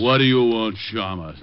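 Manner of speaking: loud mimicry of a grumbling sergeant; mocking echo. Detective parroting the sergeant's greeting, mocking echo. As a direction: throw his greeting back in his voice.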